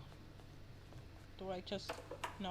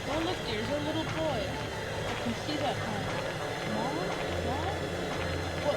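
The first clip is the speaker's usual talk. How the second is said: dream parents voice; 2 semitones higher, 7 dB louder than usual. Acted. Dead parents speaking to their son in his dream, loud and eerie. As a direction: play the ghostly parents booming over their son.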